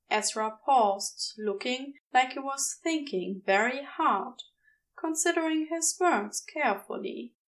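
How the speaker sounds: usual speaking voice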